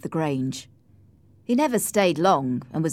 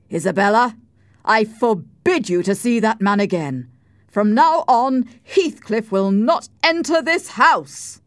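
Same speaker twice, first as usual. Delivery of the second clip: loud, forceful, raised-pitch command; shaking with anger; 5 semitones higher, 7 dB louder than usual. A narrator's reading. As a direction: forbid it as the furious brother. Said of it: "shaking with anger".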